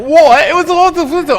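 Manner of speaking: high, very loud British gibbering; hammed-up British impression; mock British babble. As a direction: shout it high like an overexcited British comic. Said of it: "That's what she sounds to me sometimes".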